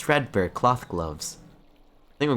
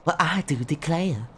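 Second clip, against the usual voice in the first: higher, genteel Southern Belle drawl; Southern lady character, pitched up; 9 semitones higher than usual. Acted. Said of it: "give her a Southern Belle voice".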